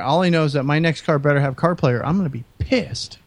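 usual speaking voice

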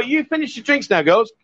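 normal talking voice

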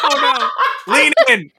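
high, loud teasing self-imitation; mock eager reply. Friend mimics herself urging Mary on, high and loud. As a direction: squeak it like an eager cheerleader. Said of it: "I'm like".

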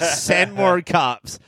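slow, high zombie groan. Retold or imitated. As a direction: stretch it out like the undead. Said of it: "that's an all-timer".

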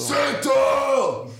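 sudden high shout. Real, not acted. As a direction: yell it out. Loud retort in banter.

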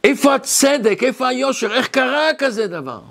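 mild, insistent string of protesting questions; retold outcry. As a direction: imitate someone crying out against injustice. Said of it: "זה עצמו שאתה צועק".

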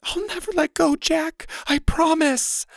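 very high, strained heroine voice; hammed-up hoarse farewell. A narrator's reading. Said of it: "she said hoarsely".

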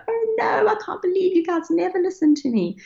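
grumpy parent, joking. Pretend. Mother playing the disobeyed parent for her kids, mock-exasperated. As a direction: wail in pretend disbelief, high-pitched. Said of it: "they know I'm joking".

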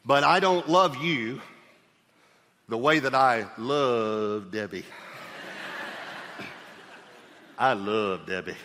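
slow, heartfelt "but I don't love you the way that I love Debbie"; tender. Real, not acted. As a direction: say it gently, as a loving husband. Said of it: "I don't want you to get your feelings hurt".

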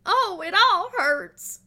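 high, loud, slow whine; hammed-up teasing whimper; mock whiny catchphrase. Family teasing an upset kid, mock whine. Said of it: "we say".

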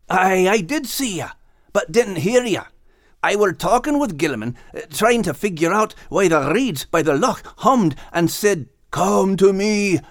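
put-on earnest young Scot explaining; Max's voice. A narrator's reading. Max telling old friends about the reeds, lightly Scottish.

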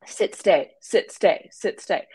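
short, separated commands as if to a puppy; dog commands. Pretend. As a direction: mimic a dog trainer's terse orders. Dog commands given as example.

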